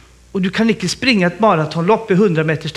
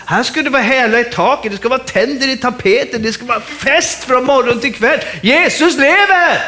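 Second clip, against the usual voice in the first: jubilant preaching; 4 semitones higher than usual. Real, not acted. Preacher calling the congregation to celebrate, building jubilantly. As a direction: whip up the party, climax in proclamation.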